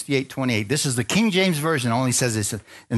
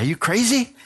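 high-pitched, laughing mock disbelief; retold disbelief; 10 semitones higher than usual. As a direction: blurt it back as an incredulous comeback. Retold startled reply to God.

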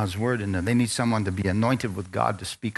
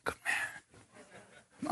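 short hushed whisper, breath only; a quick secret whisper of approval; whispered praise; 17 dB quieter than usual. Retold or imitated. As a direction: whisper it to him on the way past. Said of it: "he went like this".

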